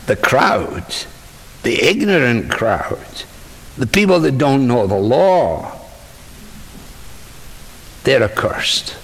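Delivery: measured contempt with long pauses; mild disdain. Acted. Pharisees dismissing the crowd that follows Jesus, disdainful. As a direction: let the scorn drop in piece by piece.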